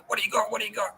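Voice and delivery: excited lizard voice. Retold or imitated. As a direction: voice the lizard begging for food, high and quick. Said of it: "freaked out".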